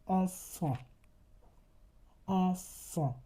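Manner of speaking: enfant with two nasal vowels, n silent; French nasal example. Shown as a demonstration. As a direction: model enfant twice.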